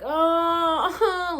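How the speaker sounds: long, high, loud pained "oh"; groan of pain. Retold or imitated. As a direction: imitate a roomful of people hurting. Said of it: "like, in pain".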